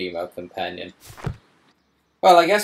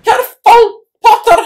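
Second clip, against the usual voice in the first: much higher and louder, shrill-sounding mimicry of the game voice; piercing voice; 16 dB louder than usual. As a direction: pierce the room with it. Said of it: "She's very piercing today".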